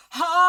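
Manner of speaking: huge high belly roar, very loud; great birthing cry. Acted. Macha at the finish line, a huge roaring birth cry. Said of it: "she lets out this great cry".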